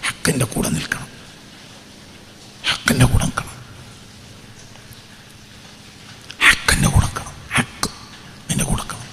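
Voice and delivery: hushed, whispered urging. Acted. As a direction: breathe the words, soft and intense. Repeated exhortation whispered. (മന്ത്രിക്കുന്ന ആഹ്വാനം)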